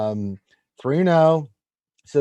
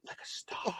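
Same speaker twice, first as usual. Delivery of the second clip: whisper; 19 dB quieter than usual. Acted. Gushing aside.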